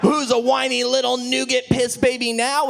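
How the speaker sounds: mocking taunt. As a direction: sneer it like a gym teacher.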